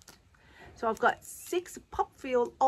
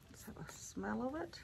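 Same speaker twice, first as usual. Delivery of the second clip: whispering; 4 semitones higher, 12 dB quieter than usual. Real, not acted. Sniffing product, voice drops to whisper.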